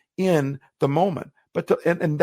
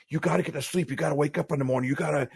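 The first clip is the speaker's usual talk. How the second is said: put-on worked-up old self; 2 semitones higher, 4 dB quieter, faster than usual. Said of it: "my old self was trying to get all worked up".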